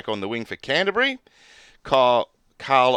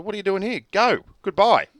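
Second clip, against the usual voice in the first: shooing away; 9 semitones higher, 4 dB louder than usual. Retold or imitated. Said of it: "I said".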